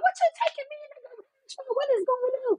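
alarmed mom. Retold or imitated. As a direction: play the mother, jumpy and suspicious, rushing the words. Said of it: "She's like".

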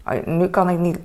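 usual speaking voice

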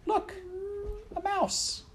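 very high excited princess; Princess Pea. A narrator's reading. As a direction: squeal it out high. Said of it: "she said".

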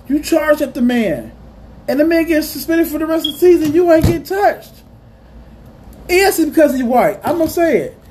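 fired-up outraged rant; heated rant. Real, not acted. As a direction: vent it, the anger climbing. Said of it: "Let me rant about this".

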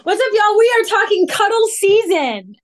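pumped-up show host greeting the audience; hyped podcast intro. Acted. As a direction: kick off the show like a big announcer. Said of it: "All right, girl, go for it".

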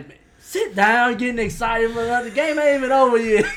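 a mother barking at her husband to sit down; exasperated wife yelling at her overexcited husband; scolding mama. Retold or imitated. Mother scolding excited dad.

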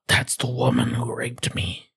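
whispered imitation of a movie character; hushed, voiceless retelling; mock whisper. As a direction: act it out in a soft whisper. Acting out film character's reaction.

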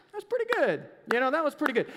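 restrained polite approval; golf clap approval. Retold or imitated. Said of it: "doing the golf clap thing".

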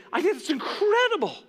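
high, delighted burst of praise; excited praise. Retold or imitated. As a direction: gush like you want to hug her. Preacher relives joy at gift.

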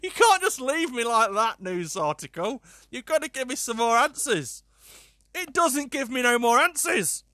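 high, loud comic outrage; exasperated protest. Real, not acted. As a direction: plead indignantly for answers. Mock outrage at the article.

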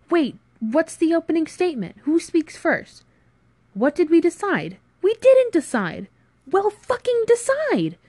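high, loud, escalating exasperation; panicked bickering. Acted. Friends argue over who speaks first, panicked. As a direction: build to a high, furious demand.

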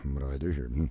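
hushed, playful wondering; quiet to himself. Retold or imitated. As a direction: muse aloud under his breath. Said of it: "I'm like".

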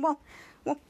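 ordinary talk

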